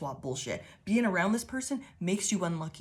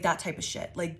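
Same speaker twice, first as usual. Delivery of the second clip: normal talking voice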